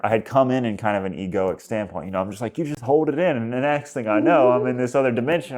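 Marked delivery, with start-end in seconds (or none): 2.6-5.6 higher voice, reliving the confusion; worked-up retelling. Retold or imitated. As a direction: act out being thrown into another world. Acting his bewildered past self.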